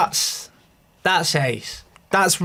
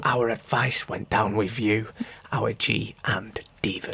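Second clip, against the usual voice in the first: whispering; 7 semitones lower, 8 dB quieter than usual. Acted. Trailing off the intro.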